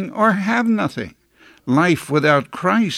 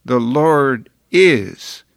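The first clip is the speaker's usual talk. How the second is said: slow The Lord is, then a full stop on "is"; The Lord is, said slowly and cut off at "is"; abrupt stop after is; 3 semitones lower, much slower than usual. Retold or imitated. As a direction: stop dead after "is", falling. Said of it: "stopped abruptly at the end of the third word".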